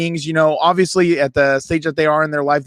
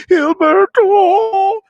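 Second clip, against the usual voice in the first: high, loud, drawn-out name call; mock yell; 6 dB louder, much slower than usual. Calling his brother in frustration.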